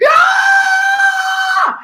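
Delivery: very high, loud scream; bursting into the stall, screaming high and loud. Retold or imitated. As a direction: scream it all out. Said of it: "I gotta scream to get it out".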